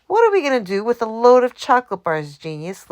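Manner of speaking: a thief demanding answers from his partner. A narrator's reading. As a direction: throw the question at your partner. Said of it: "Lefty demanded of Louis".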